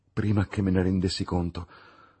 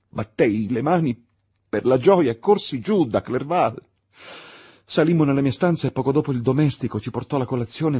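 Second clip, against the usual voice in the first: louder, higher, racing with glee; feverish joy; 6 semitones higher, 8 dB louder than usual. A narrator's reading. Victor recalling his wild relief, loud and high. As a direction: let the mad joy burst out.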